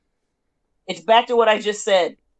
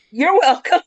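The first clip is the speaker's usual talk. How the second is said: higher, amused mock politeness; amused retort; 7 semitones higher, 4 dB louder than usual. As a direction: laugh through a wry courtesy. Joking reply, laughing after zero.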